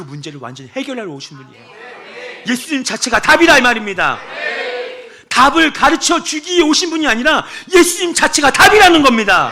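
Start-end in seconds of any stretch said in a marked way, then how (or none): 2.5-9.5 emphatic preaching. Acted.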